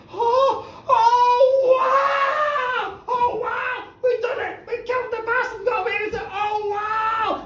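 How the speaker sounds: very high, loud gleeful shouts; elated cheering. Acted. Couple celebrating getting away with murder, high gleeful shouts. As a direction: burst out cheering.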